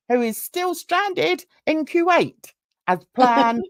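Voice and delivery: slow, formal news anchor read; newsreader voice. Pretend. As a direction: announce it like the evening news. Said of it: "my newsreader voice".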